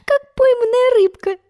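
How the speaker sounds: put-on high, laughing girl's voice; high giggly delight; gleeful girl. A narrator's reading. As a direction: tease him like a caught fish. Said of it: "веселилась Алиса".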